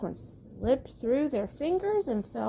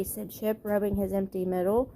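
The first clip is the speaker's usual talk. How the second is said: plain reading voice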